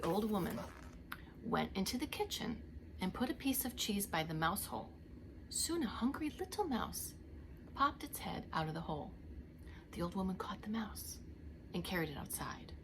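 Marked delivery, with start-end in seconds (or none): 3.0-12.7 soft, secretive telling; hushed suspense. A narrator's reading. Narrating the mouse trap quietly to children. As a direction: lean in and keep it hushed.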